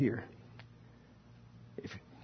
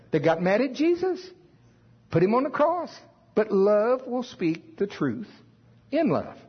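much higher, fervent sermon peak; impassioned climax; 8 semitones higher than usual. Acted. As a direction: lift the voice high on the climax.

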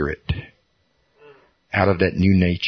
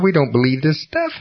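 ordinary delivery

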